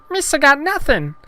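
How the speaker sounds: very high, loud alien voice; Jar Jar Binks. Acted. Gungan finds no taste, very high goofy voice. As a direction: report it in squeaky cartoon falsetto. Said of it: "Jar Jar licked the fruit".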